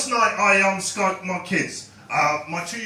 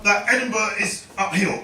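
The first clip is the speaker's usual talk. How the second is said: usual speaking voice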